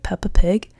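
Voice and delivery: unsure whisper, half to herself. Shown as a demonstration. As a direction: breathe it out as a doubtful aside. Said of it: "I don't know which one it is".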